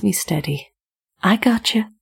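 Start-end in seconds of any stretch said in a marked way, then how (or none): 1.2-1.9 higher, lilting reassurance; sing-song. A narrator's reading. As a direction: sing it lightly to calm her. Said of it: "in a sing-song tone".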